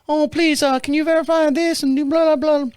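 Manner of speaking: put-on high, girlish rep voice; little girl on phone. Bank rep asking caller to verify, high little-girl voice. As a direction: rattle off verification in a girlish pitch. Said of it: "I get this little girl on the phone".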